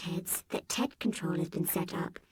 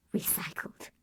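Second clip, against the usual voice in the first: whispered, softer; hushed; 5 dB quieter than usual. A narrator's reading. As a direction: drop to a whisper for the bad news. Robot tells grim fate.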